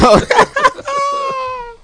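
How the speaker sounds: high wheezing burst of laughter. Real, not acted. Player laughing at memories he won't share, high and loud.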